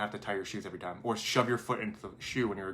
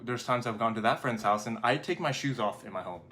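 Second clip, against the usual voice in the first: ordinary delivery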